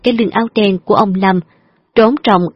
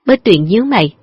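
plain reading voice

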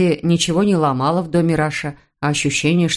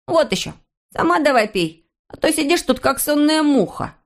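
put-on scornful child's voice, higher; snorting boy; 8 semitones higher than usual. A narrator's reading. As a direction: snort it out like a cheeky kid. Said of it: "фыркнул".

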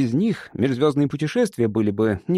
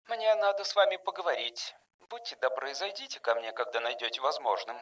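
very soft, distant speaker voice; a woman's polite summons, faint through the intercom; woman over intercom; 10 dB quieter than usual. A narrator's reading. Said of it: "произнёс динамик женским голосом". (тихий женский голос по связи)

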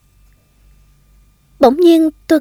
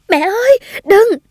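high-pitched, urgent call; screamed plea; 10 semitones higher than usual. A narrator's reading. Child to mother running into the burning house, screamed plea. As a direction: cry out high in alarm to stop someone. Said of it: "tôi vẫn thét lên".

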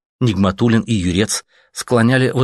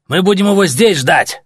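higher and much louder yell; 11 semitones higher, 6 dB louder than usual. Acted. Soldier yelling at the machine gun and snipers. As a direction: bellow it at the guns. Shouting to the officer.

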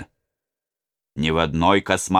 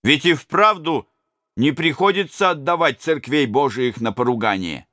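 robber in the crowd; 8 semitones higher than usual. Acted.

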